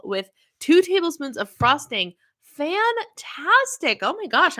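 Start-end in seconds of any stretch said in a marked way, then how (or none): 2.4-4.6 excited. Real, not acted. Excited reaction to viewer dessert.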